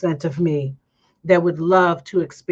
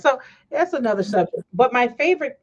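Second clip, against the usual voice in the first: normal talking voice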